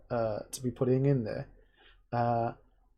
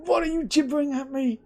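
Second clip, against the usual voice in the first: groggy annoyed wife; 11 semitones higher than usual. Retold or imitated. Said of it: "And she's gonna be like".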